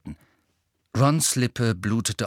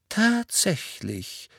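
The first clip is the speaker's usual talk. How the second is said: slow, drawn-out, mildly intrigued; faint interest; 9 semitones higher, much slower than usual. A narrator's reading. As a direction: let a trace of curiosity show. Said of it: "erstmals eine schwache Spur von Interesse". (langsam, leicht interessiert)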